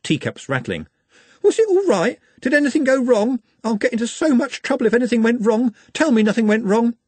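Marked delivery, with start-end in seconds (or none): 1.4-7.0 high, urgent worried questioning; anxious fretting. A narrator's reading. Maid frets to Glenda about trouble, anxious. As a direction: fret in a high-pitched panic.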